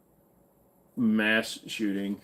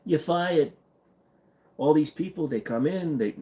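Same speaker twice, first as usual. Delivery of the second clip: failed presidential impression, pitched up; faltering, higher-pitched mock politician; Trump; 5 semitones higher than usual. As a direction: attempt a politician's voice and miss. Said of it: "when I try to do a Trump".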